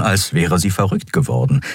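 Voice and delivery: usual speaking voice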